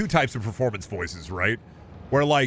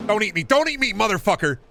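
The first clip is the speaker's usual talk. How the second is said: mock panic; 11 semitones higher, 4 dB louder than usual. Voicing game character being eaten.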